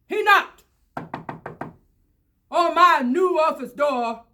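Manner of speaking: usual speaking voice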